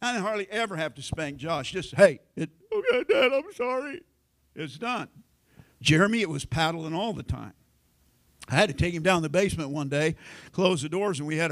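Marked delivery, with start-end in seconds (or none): 1.9-5.1 put-on high, laughing kid's quick apology; imitating the son. Preacher imitates his son saying sorry to dad, high and sheepish. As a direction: squeak out a sheepish sorry like a boy.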